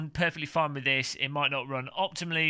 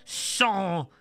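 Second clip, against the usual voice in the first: put-on slow, drawn-out French accent; mock French accent; 3 semitones higher, 4 dB louder, much slower than usual. Vlogger reading the French instructions, exaggerated French accent. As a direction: ham up the French, stretching every word. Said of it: "Here we go, the French".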